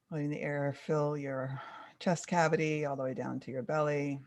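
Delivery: soft, unhurried guided breathing; calm grounding exercise. Acted. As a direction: gently lead the listener into stillness.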